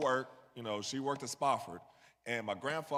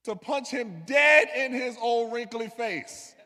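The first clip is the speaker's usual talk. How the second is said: louder, higher, slow punch to each word; heated punchline; 8 semitones higher, 9 dB louder, slower than usual. Real, not acted. Speaker telling crowd his first instinct, loud and heated. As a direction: land the punchline hard. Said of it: "my first instinct".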